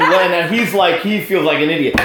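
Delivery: normal talking voice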